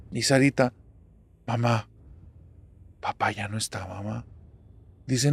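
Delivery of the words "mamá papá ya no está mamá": gentle, hushed. Acted. Daughter telling mother that papá is gone, softly. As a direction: break it to them quietly, voice dropping.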